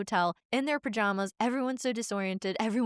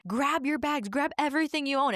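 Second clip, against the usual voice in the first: higher, mildly raised mock scream; imitating screaming sister; 5 semitones higher than usual. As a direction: rush the order like a panicking sister. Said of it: "she starts screaming".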